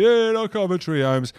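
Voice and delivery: lightly voiced van driver; mock delivery driver.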